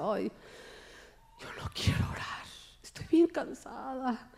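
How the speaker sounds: whispery, very soft weary groan; acted tiredness. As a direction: whine it softly as if worn out. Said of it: "bien cansada. Y yo decía".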